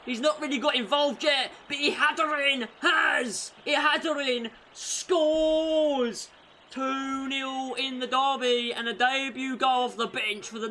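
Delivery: high, loud, slow, stretched-out goal call; goal celebration. Real, not acted. Commentator roaring as Ihataren scores on his debut, ecstatic. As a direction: roar the goal like a stadium announcer.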